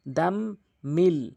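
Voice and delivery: দাম nasal, মিল plain, slowly; tajweed contrast. Shown as a demonstration. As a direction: hum দাম, keep মিল clean. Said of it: "দাম গুণনা করবেন এবং মিল গুণনা করা যাবে না".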